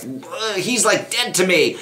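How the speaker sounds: put-on dramatic teen complaint. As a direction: act out an overdramatic note to the parents. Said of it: "I would write my parents notes".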